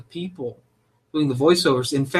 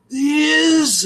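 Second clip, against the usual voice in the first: much higher, strained, very slow; straining voice; 13 semitones higher, 6 dB louder than usual. Retold or imitated. Mocking a narrator's character voice, strained and slow. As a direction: squeeze it out like straining hard. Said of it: "straining on the toilet".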